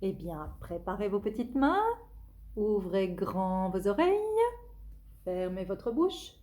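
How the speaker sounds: plain reading voice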